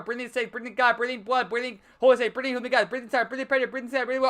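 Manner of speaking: rising from steady reading to high frantic chant; building frenzy. Acted. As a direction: speed into a manic list. Poem grows frantic, builds up.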